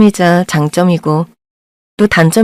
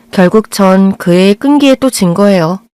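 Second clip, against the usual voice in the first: ordinary delivery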